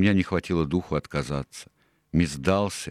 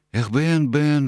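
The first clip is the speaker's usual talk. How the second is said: high, louder lament; rueful sigh; 9 semitones higher, 5 dB louder, much slower than usual. A narrator's reading. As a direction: sigh the name out, regretfully. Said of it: "вздохнул он". (со вздохом, высоко и сокрушённо)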